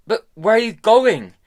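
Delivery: high loud desperate call; shouting after her. A narrator's reading. Tom calling out to Hester, loud and desperate. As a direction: yell the question after her.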